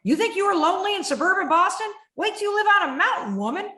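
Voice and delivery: high, loud, fast sneering taunt; mocking inner critic. The inner critic taunting about the mountain, high and sneering. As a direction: jeer at her like a nasty bully. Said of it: "she's like".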